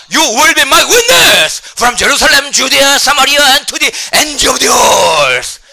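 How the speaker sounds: high, slow, full-force shout; booming proclamation. Shown as a demonstration. Preacher proclaims the Acts commission in English, slow booming shout. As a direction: thunder the verse, stretching every word.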